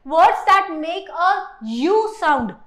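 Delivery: very slow, drawn-out heading; teacher announcing a new rule; slow emphatic heading. Shown as a demonstration. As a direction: announce the rule slowly, word by word. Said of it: "ध्यान से देखते हैं".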